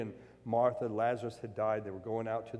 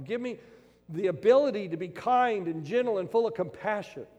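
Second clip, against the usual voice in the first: loud, high-pitched preaching; fervent pulpit preaching; preaching at full force; 7 semitones higher, 7 dB louder than usual. Acted. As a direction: drive the point home to the congregation.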